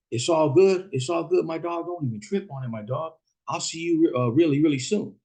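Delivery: high-pitched imitation of a rival homeboy; put-on high voice, veiled threat; mock casual menace. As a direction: pitch it up, feigning it's all good.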